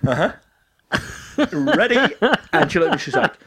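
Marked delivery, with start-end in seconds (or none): 0.0-2.0 cheeky tease. Retold or imitated. Playful drunk tease, high.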